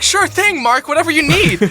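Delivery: high, eager-to-please voice; fawning, high-voiced compliance; starstruck. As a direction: agree like smitten parents, pitched high. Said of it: "they were equally starstruck".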